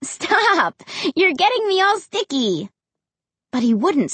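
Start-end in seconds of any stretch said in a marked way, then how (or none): 0.0-2.7 high-pitched, squealed objection; playful protest. A narrator's reading. Girl fending off her licking dog, playfully protesting. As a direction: squeal it in mock complaint.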